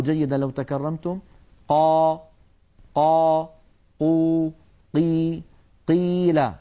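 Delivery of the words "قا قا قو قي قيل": "قا، قو، قي" each struck clean and held; long level syllables, no breath after the ق; airless qaf drill. Shown as a demonstration. Teacher asking students to listen, clean voiced qaf. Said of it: "لأن القاف حرف مجهور".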